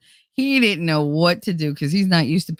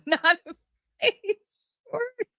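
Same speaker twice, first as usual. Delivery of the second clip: laughing; 4 dB quieter than usual. Real, not acted. Teasing punchline, voice cracks up.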